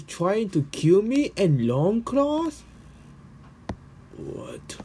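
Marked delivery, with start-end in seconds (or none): 4.2-4.8 a startled whispered what. Acted. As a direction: hiss the question under your breath. Shocked aside.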